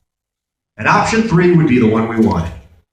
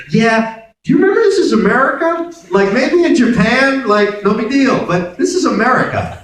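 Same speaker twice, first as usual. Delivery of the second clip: higher, louder, incredulous; mock incredulous objection; 11 semitones higher, 4 dB louder than usual. Producer objecting to crucifixion scene, higher incredulous scoff. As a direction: scoff at the idea it could air. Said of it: "And I said".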